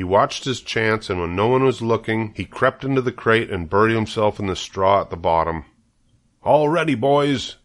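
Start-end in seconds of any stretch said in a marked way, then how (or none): 6.4-7.6 higher-pitched rallying call. A narrator's reading. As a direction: call the boys to get going. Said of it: "cried Mister Zuckerman".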